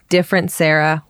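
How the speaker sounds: normal talking voice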